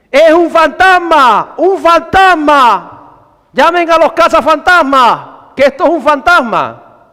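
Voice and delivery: high, loud, drawn-out cries of panic; hammed-up terrified shouting. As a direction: cry it out like panicking sailors. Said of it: "de miedo se pusieron a gritar".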